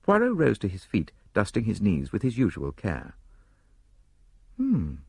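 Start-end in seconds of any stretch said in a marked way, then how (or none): 4.6-5.0 put-on high, slow concession in an accented voice; grudging admission. A narrator's reading. Poirot considers Hastings's suggestion, slowly admitting it. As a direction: mull it over, drawn out. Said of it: "he admitted".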